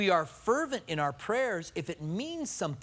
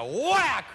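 loud, high shouted whack; mock whack; 7 semitones higher, 5 dB louder than usual. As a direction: shout the sound of the hit. Wife throws the flowers.

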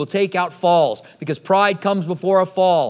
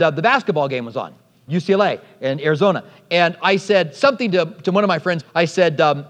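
usual speaking voice

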